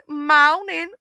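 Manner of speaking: "moaning" for morning, no R sound. Shown as a demonstration. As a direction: say "moaning" with the R dropped. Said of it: "we say moaning".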